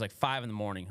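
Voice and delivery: normal talking voice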